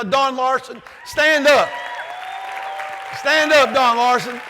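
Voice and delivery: loud, high call across the hall. Real, not acted. Speaker to his old coach in the crowd, loud call. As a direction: call him to his feet.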